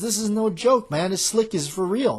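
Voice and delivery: a frightened informant insisting it's real; nervous informant. Retold or imitated. Nervous informant voiced in retelling.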